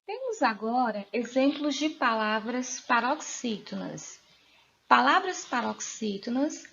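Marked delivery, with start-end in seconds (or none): none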